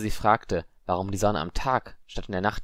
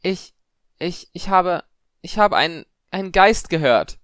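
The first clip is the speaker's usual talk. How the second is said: high, halting, growing louder; panicked stutter; 10 semitones higher, 8 dB louder than usual. A narrator's reading. Shaken girl reporting a ghost, stammering. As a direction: stumble over the words, then blurt it out. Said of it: "stotterte sie".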